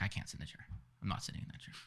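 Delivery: whisper. Retold or imitated. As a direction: whisper the refusal. Refusing the chair, scared.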